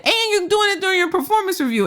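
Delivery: high, fast, incredulous; incredulous scolding. Retold or imitated. Host scolding cousin about raise timing, fast high disbelief. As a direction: rattle it off in disbelief. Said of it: "I said".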